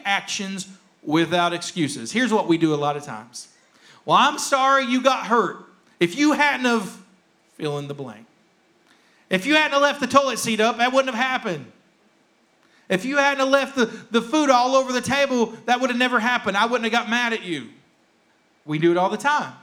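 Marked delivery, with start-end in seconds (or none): 4.0-7.0 higher, louder mock-defensive protest; blame-shifting non-apology. Preacher mimicking what we do a lot of times, mock defensive. 9.3-17.7 high-pitched, emphatic defensive complaint; mock blaming. Preacher acting out a toilet seat excuse, mock accusing. Acted spouse blaming the other.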